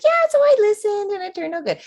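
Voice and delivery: high, fast mock-casual voice; mocking a casual client. Medium teasing a client's casual report, high fast mock voice. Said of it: "You're like".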